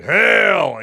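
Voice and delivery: loud hail; mock battle cry from a chat message; shouted hail. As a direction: belt out a hearty hail. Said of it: "in the live chat here saying".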